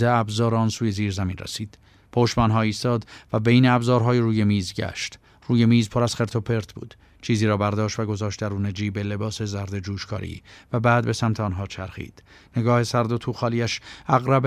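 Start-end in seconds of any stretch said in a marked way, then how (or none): none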